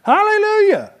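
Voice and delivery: very high sustained hallelujah; ecstatic hallelujah. Real, not acted. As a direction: soar up on hallelujah. Ecstatic shout after laugh.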